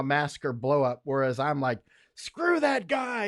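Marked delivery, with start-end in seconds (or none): 2.1-3.3 much higher, slow, drawn-out scoff; defiant scoff. Retold or imitated. Telling off a British traitor, slow high scoff. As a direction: sneer it out slowly, way up high.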